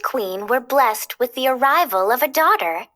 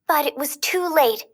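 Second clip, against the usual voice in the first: ordinary delivery, nothing marked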